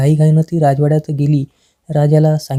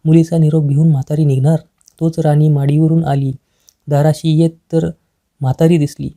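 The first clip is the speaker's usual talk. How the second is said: usual speaking voice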